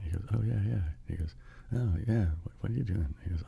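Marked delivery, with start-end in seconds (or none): 0.3-0.8 slightly higher, offhand agreement; casual acted reply. Bill answers the man who recognized him, casual acted reply. 1.7-3.1 higher, chatty put-on question; casual acted small talk. One Bill quizzes the other on meeting, casual small talk.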